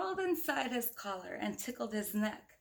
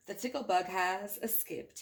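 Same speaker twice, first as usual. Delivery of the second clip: ordinary delivery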